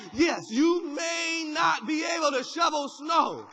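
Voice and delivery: loud, high, rising preacher's call; preaching at full force. Real, not acted. Pastor rallying the congregation, preaching at full force. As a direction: drive it home to the church.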